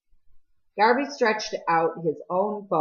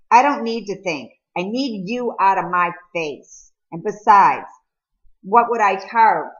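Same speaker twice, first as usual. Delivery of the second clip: louder, sharp and defensive; irritated retort; 4 semitones higher, 5 dB louder than usual. Acted. Peter snaps at Cole to leave him alone, irritated. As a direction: push him away hard.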